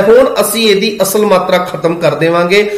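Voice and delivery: ordinary delivery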